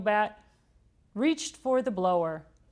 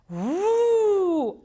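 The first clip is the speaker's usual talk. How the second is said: high loud woo; excited whoop; 12 semitones higher, 6 dB louder than usual. A narrator's reading. Narrator cheering the flying bonnet, whooping. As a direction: let out a delighted whoop.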